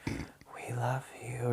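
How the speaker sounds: very slow, faint whisper; drawn-out whispered impression of mom; creepy whispering mom. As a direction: imitate an eerie mother, whispering slowly. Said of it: "like, saying weird shit".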